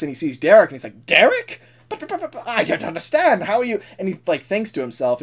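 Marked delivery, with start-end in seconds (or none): 1.1-3.8 high, stammering disbelief; startled Bowler. Acted. Bowler spotting Derek in the works, baffled. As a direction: burst out high, then stumble on buts. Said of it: "he stops in the works".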